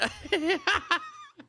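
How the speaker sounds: helpless high laugh; high burst of laughter. Real, not acted. Big laugh.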